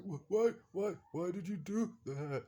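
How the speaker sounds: high, gasping mock plea; high-pitched pleading in mock agony; hurt, winded son. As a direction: act out a pained, strained cry. Acting out injured son on ground.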